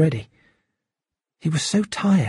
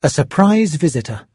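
plain reading voice

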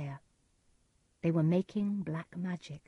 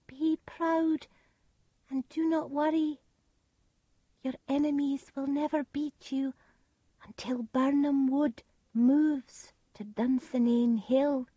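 put-on much higher child's voice; 8 semitones higher than usual. A narrator's reading. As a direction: speak as a crowned child. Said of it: "it said (crowned child)".

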